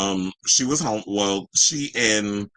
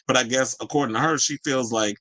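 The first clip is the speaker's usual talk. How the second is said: ordinary talk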